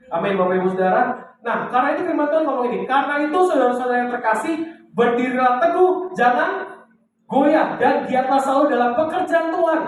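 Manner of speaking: high, sustained exhortation; full-force preaching. Real, not acted. Pastor urges congregation to stand firm, preaching at full force. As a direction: drive each phrase home in a high, ringing preacher's pitch.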